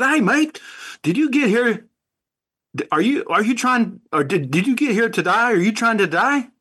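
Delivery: high-pitched, hammed-up Aussie questioning; Australian accent. American asks the Aussie soldier in the joke, high Australian accent. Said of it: "you got that accent down really good".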